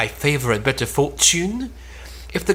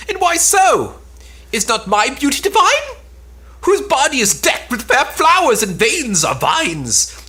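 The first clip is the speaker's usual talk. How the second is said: indignant outrage; 8 semitones higher than usual. Acted. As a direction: rise high in wounded pride. Tellus outraged at being slighted.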